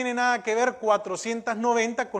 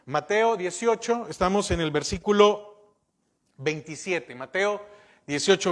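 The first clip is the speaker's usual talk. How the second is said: usual speaking voice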